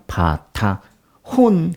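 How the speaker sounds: 바타혼 stretched very slowly, each syllable held; careful Hebrew. Shown as a demonstration. Teacher showing how to read 바타혼, very slow. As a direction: sound out 바타혼 slowly for the class. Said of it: "그렇게 읽어요".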